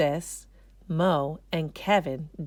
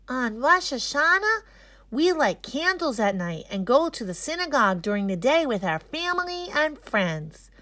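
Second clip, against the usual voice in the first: put-on higher, lively child voice; cheerful kid presenter; 7 semitones higher, 3 dB louder than usual. A narrator's reading. Mo tells the class about Rosh Hashanah, cheerful. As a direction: tell the class about your holiday happily.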